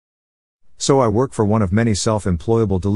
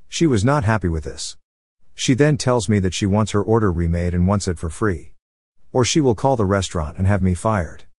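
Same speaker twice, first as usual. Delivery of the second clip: ordinary delivery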